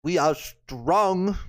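clumsy slow Russian impression; Russian accent. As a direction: attempt a Russian accent, drawn out. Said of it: "I gotta do a Russian impression".

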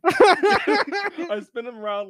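high, loud and slow through laughter; real laughing. Real, not acted. Storyteller recounts his one fight to friends, laughing. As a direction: laugh while telling the story.

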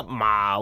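まあ pitched low and held; third tone. Shown as a demonstration. Teacher giving the word for horse, low まあ. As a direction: drop まあ low and stay. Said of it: "これ第三声なんですが".

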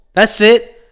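usual speaking voice

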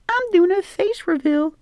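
put-on very high falsetto girl voice; pretend woman. Man pretending to be a woman on TikTok, high falsetto. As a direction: squeak it out like a coy influencer. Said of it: "be like".

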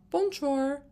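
mock French bonjour. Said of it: "I think of Otmar".

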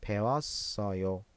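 pewassayo said slowly with the pitch dropping at the end; falling statement tune. Shown as a demonstration. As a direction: state it, letting pewassayo settle downward. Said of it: "صدا میاد به سمت پایین".

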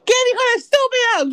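loud, high-pitched Spanish indignation; mock outrage. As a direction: play an offended onlooker, loudly. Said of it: "gonna be like".

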